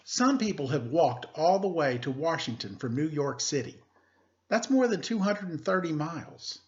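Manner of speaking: teacher reading a picture book aloud; story reading. Acted. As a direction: clear read-aloud storytelling voice.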